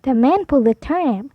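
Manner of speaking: ordinary delivery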